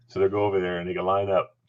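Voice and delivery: normal talking voice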